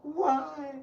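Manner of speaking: high mock sob into a wailing why; imitated wailing. Wife crying to her husband, high imitated wail. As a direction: wail like she's heartbroken. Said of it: "She went".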